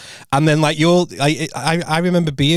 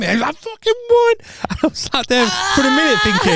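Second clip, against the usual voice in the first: can barely get the words out for laughing. Real, not acted. Telling a friend the prank story while laughing. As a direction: voice cracking high with laughter. Retelling winning prank, cracking up.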